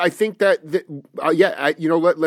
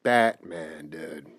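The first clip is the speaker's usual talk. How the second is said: much lower, dropping away at the end; low Batman echo; 11 semitones lower, 7 dB quieter, much slower than usual. Pretend. Host echoing the guest's Batman sign-off, in a low voice. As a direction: drop way down and trail off softly.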